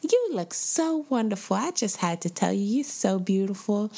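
put-on high sweet old lady gushing; airy gushing darling tone. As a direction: gush like a kindly granny in the aisle. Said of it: "she's like".

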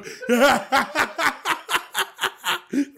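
high, loud burst of cackling laughter. Real, not acted. After his fake rant, bursting into loud cackling. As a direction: crack up hard at your own joke.

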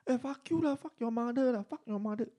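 high mocking curse; cursing driver. Driver swearing at the riders, high mock curse. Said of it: "Then he like".

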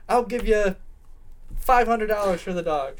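high, loud acted offer; urgent outbidding. Girl pops up out of nowhere to outbid, urgent. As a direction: burst in with a mock-desperate bid.

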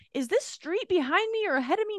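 high-pitched mock scream out the window; imitating a frantic shouted question; retold scream. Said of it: "I scream out my window".

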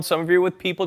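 normal talking voice

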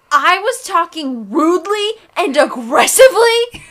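high, very loud indignant shout, mimicked; imitated loud yell. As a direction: mimic someone yelling an outraged question. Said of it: "turn your volume down for this".

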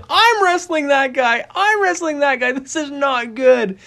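high, drawn-out mock panic; panicked inner voice. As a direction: wail it slowly as a dread-filled thought. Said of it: "I'm kinda nervous and I'm kinda scared".

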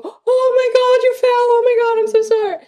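loud, high-pitched hammed-up panic over a child's fall; exaggerated squealed alarm, rushing into a frantic apology; mock catastrophizing. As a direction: overreact wildly, as if faking horror. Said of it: "don't catastrophize it".

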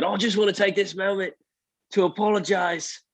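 mock Irish accent rising to a high shout; hammed-up Irish brag, yelling the punchline; imitating Conor McGregor. Retelling a fighter's victory speech to a friend, mock Irish shout. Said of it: "and then he said".

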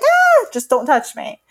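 sudden high pitched yell of don't touch me; a frazzled mom losing it at her clingy son; retold scream. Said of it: "maybe I can scream and be like".

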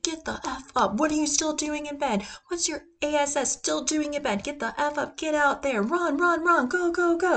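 imitating David Goggins yelling at him to get out of bed. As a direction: bark him out of bed like an angry trainer. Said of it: "he's like".

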